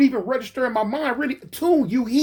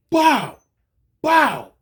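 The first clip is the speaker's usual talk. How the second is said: loud vocal gunshot bangs; imitated gunshots; 6 semitones higher, 4 dB louder than usual. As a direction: shout out two sharp gunshot noises, spaced apart.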